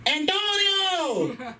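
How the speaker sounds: put-on high, drawn-out accented call of "Antonio"; hammed-up accented name, calling the dog; exaggerated accent. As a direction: sing out Antonio slowly in a fake accent. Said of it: "With an accent and shit".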